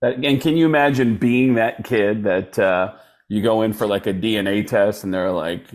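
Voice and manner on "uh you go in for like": ordinary delivery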